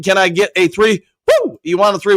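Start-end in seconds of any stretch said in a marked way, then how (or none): 1.3-1.6 high cheering woo; excited whoop. Real, not acted. Cheering a fan's request.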